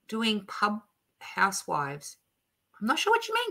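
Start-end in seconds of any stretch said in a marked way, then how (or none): 2.8-3.5 puzzled. Real, not acted. Puzzled at a viewer comment.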